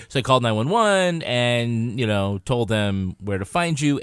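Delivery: ordinary talk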